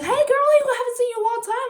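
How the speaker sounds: high, loud, rushed squeal of hello; excited greeting. Retold or imitated. Acquaintance greets her at the store, high excited gush.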